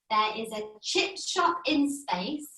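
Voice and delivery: slow, careful repeat of the model. Shown as a demonstration. Child repeats the teacher's tongue twister, carefully. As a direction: copy it slowly and softly. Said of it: "So it goes like this".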